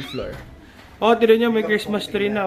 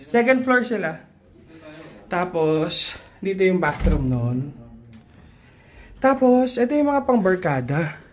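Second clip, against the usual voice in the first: ordinary talk, nothing marked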